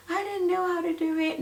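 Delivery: high-pitched mock helplessness; clueless parent, mocked. Viewer claiming not to know how, mock-helpless. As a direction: whine it high in a put-on voice. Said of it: "So you're like".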